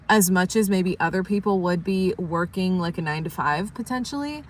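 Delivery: normal talking voice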